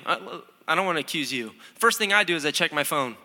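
usual speaking voice

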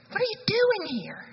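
a high, astonished what are you doing here; incredulous. Shown as a demonstration. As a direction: ask it like you can't believe your eyes. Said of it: "I do wonder what the inflection was".

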